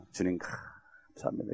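slow, hushed whispered thanks; a slow, reverent whisper to God; whispered prayer. Retold or imitated. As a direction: breathe the prayer out slowly and reverently. Daughter's father in sincere, whispered thanks.

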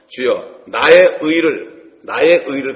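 loud, drawn-out psalm cry, very slow; fervent plea. Acted. David pleading his righteousness to God, loud slow cry. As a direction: cry out to God, stretching each word. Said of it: "그런 얘기를 많이 했어요".

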